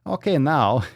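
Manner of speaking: put-on muddled Canadian-meets-Kazakh comic accent; Canadian Borat. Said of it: "It was like a Canadian Borat".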